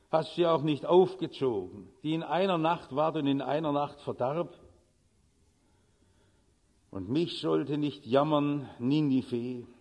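low, measured Bible reading; scripture reading. Acted. Preacher reading Jonah to the congregation, solemn scripture voice. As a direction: read the verses solemnly from the pulpit. Reads Jonah passage aloud.

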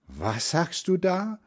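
high, rising astonished question; amazed grandmother's question. A narrator's reading. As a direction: ask in disbelief, pitch climbing. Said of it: "fragt Großmutter erstaunt".

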